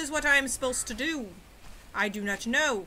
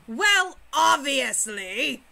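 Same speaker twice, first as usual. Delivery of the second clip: sarcastic; 5 dB louder, much slower than usual. Real, not acted. Sarcastic slow obviously.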